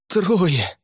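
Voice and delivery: high, forceful shout; exclaimed cry. A narrator's reading. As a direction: cry it out on reaching safety. Cried out at the rescue's end.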